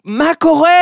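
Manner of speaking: high, loud comic shout of a name; retold shout. Joker yelling at the bald giant as if at his friend. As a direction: yell it like greeting an old friend across the hall. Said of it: "והוא צועק לו".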